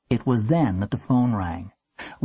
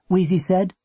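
ordinary delivery

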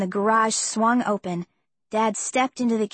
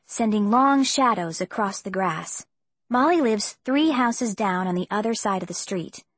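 plain reading voice

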